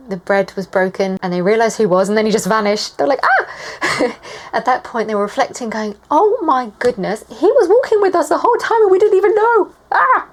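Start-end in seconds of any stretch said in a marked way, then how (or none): 3.2-4.2 high mock-startled "ah"; short high yelp of surprise; startled cry. Disciples' reaction when he vanished. 6.1-10.3 high, loud amazed outburst ending in a cry; hammed-up, excited disbelief; astonished realisation. Acts disciples' astonished realisation.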